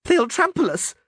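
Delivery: very high, panicked cry; Dandelion's shout. A narrator's reading. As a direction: shout in terror from under the crowd. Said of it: "Dandelion shouted from the ground".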